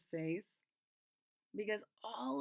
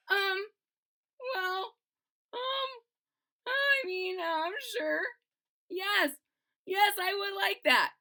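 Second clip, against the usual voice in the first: nervous, higher hesitant yes; shy higher voice; 7 semitones higher, 3 dB louder than usual. Acted. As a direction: accept shyly. Shy bean accepts help.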